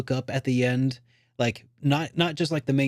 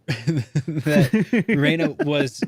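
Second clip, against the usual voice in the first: laughing; 3 semitones higher, 5 dB louder, much slower than usual. Real, not acted. Laughing mid-sentence.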